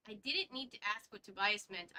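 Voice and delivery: normal talking voice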